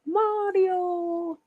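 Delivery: high, drawn-out Italian "MAH-dio"; mock Italian accent. Streamer clowning over pronunciation, hammed-up Italian accent. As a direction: sing out Mario like a cartoon Italian.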